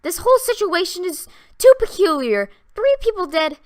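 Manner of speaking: high, loud and rattled; upset young woman. A narrator's reading. Vera upset after three deaths.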